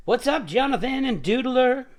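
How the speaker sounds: loud, high excited greeting; hearty shout-out. Real, not acted. Host greets viewers just joining the stream, hearty shout-out. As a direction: call out a big hello to the new arrivals.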